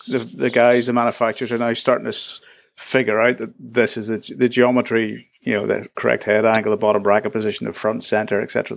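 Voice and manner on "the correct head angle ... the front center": ordinary talk, nothing marked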